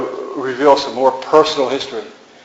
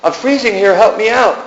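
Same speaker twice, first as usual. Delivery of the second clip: shouting for help; 9 semitones higher than usual. Acted. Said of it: "when I shouted".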